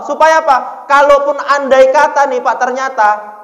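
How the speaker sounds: loud, ringing sermon delivery; full-force preaching. Acted. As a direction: hammer it home to the crowd.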